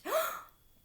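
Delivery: a high startled gasp; sharp gasp. A narrator's reading. Alexander gasping as he realizes the truth. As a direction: suck in air in shock. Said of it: "Alexander gasped".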